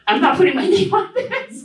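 half-laughing, half-horrified at putting her name on it; laughing inner aside. Retold or imitated. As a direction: chuckle through the private thought. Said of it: "That's what I said in my head".

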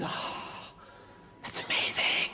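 breathless hushed wonder; awed whisper. Retold or imitated. Fan telling the speaker in an awed whisper. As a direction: whisper it like a revelation. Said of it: "They've seen that".

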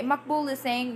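usual speaking voice

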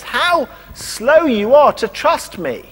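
slow, high-pitched exasperated reproach; a bit exasperated. Retold or imitated. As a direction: draw out the words in acted exasperation. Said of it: "almost a bit exasperated about them".

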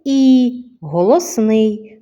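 І and голосний drawn out slowly; slow down on the vowel; slow emphasis. Shown as a demonstration. Teacher stressing the vowel for the children. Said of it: "Другий звук у слові".

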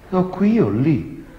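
put-on high, loud, slow coy girl. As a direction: answer like a cheeky girl. Girl answers the priest.

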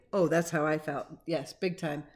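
plain reading voice

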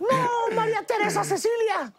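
very high, laughing melodramatic cry; mock dramatic exclamation. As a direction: exclaim in hammed-up shock. Host mock-exclaims at the story.

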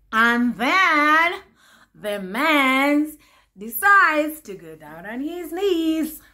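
high, loud excited reveal; building up the big news. Real, not acted. Telling viewers about the proposal, excited and loud.

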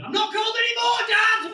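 high, loud mock yell to dad; mock shout. Comic yelling to his dad from Florida, mock shout. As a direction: shout it gleefully across the ocean.

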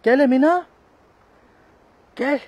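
very high, loud sing-song call. Real, not acted. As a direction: call the cat's name far across the park. Calling cat, very high. (sehr hoher, lauter Lockruf)

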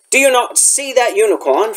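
high-pitched outraged cry; bellowing. A narrator's reading. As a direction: bellow it at him. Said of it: "Firenze bellowed at Bane".

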